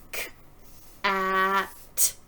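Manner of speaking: "K, a, t." each sound said apart with pauses; sounding out words. Shown as a demonstration. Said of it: "Watch again while I move the tokens for each sound".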